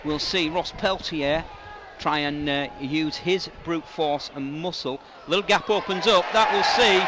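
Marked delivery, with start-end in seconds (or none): 5.3-7.0 excited commentary. Acted. Live call, gap opens.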